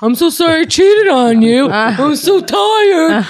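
high, loud, hammed-up sleepy apology; mock apologetic lover. To his partner, a high hammed-up tired apology. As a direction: play the exhausted cheater pleading sorry. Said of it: "And I was like".

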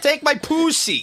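very high, slow mock Israeli accent. Mocking how they ruin beautiful words, Israeli accent. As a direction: drag it out in a squeaky Israeli accent. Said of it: "read this in an Israeli accent".

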